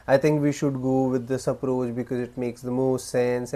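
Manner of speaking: unemphatic, rambling statement trailing into filler; weak delivery. Shown as a demonstration. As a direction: run it on in one breath, no pauses. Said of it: "the weak idea is".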